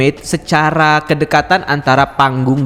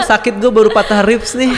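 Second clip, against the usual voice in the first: laughing through pain; 7 semitones higher than usual. Real, not acted. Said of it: "jangan bikin gue ketawa dong".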